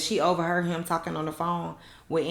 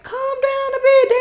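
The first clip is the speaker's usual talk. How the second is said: high exasperated scold; 7 dB louder than usual. Real, not acted. Scolding the TV character.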